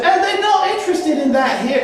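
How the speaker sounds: high-pitched mock Cockney British voice; bad Cockney accent. Host mimics his British colleague to the audience, mock Cockney. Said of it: "I only do Cockney British accent".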